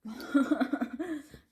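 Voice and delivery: quick embarrassed laugh; short laugh. Real, not acted. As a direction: laugh it off lightly. Laughs after burning tongue.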